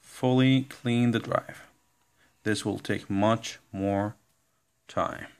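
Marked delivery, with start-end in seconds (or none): none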